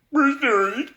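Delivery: high, loud squeaky sorry; mocking apology. Mocking TV doctors forced to apologize, high squeaky voice. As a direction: ham up a meek forced apology.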